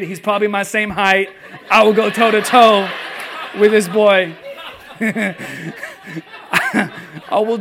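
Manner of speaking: loud, laughing mock threat; laughing overblown vow to fight a first grader; mock furious dad. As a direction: ham up a protective dad ready to fight. Dad's mock rage text retold.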